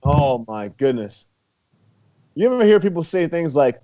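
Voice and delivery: usual speaking voice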